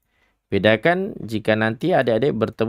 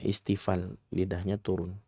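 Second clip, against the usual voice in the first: normal talking voice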